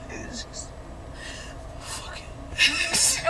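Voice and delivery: whispered, words breaking off; fake teary whisper. Prank on girlfriend, fake teary whisper. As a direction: act too upset to finish. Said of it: "she's finna fake act like she crying".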